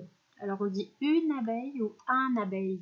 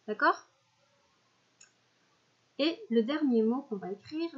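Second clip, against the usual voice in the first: usual speaking voice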